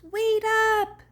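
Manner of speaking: high, lightly voiced call; a little monkey piping up. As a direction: call after the others. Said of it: "It goes".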